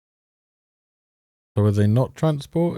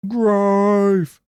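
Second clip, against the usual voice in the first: "growth" jumped much higher and louder, stretched out; high mocking "growth"; mock emphasis; 10 semitones higher, 4 dB louder than usual. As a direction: echo "growth" in a high, loud, sing-song mock. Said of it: "She's saying".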